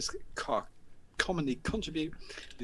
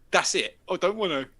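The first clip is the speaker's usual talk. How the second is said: louder sneering echo of I don't want to; mocking lad; 6 semitones higher, 6 dB louder than usual. Lad mocking the narrator's refusal, louder and sneering. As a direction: throw his words back at him.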